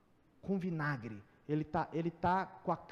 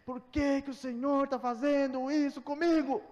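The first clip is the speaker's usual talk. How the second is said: louder, higher, furious yell; furious shout at God; 8 semitones higher, 8 dB louder, slower than usual. Retold or imitated. Preacher retelling yelling at God in broken-down car, loud furious shout. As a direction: hurl it at heaven from the stalled car. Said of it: "eu falei".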